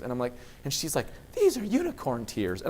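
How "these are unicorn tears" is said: put-on dreamy child voice. Daughter voiced, slow.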